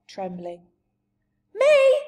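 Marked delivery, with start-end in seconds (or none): 1.5-2.0 very high, loud giant shout; huge one-word giant outburst; mighty shout. Acted. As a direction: bellow it in shocked protest. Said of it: "shouted the giant, his mighty voice".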